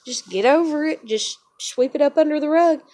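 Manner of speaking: hammed-up dismissive parent; mocking dismissal. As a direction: mimic mom waving it away. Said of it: "My mom thought it was just".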